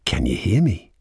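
usual speaking voice

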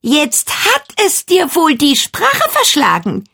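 high, sneering taunt; high, taunting jeer; mocking. A narrator's reading. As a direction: gloat at the silent mirror. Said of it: "sagte Jennifer höhnisch".

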